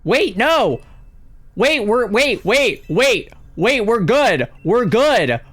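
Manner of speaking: frantic high urgent pleading; real game panic, voice climbing; panicked. Real, not acted. Streamer panicking as the raid boss hits them, frantic high calls.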